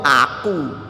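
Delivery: a hint of a smug, boastful "Aku"; arrogant boast. Successful but godless child bragging about himself, lightly smug. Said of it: "dia sombong".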